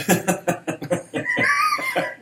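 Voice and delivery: high, drawn-out laughing fit; real laugh. Real, not acted. Host hears co-host would have run away, high real laughter. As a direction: burst out laughing at the joke. Laughs at pants-peeing joke.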